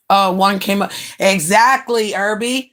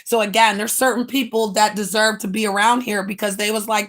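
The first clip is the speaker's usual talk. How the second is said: usual speaking voice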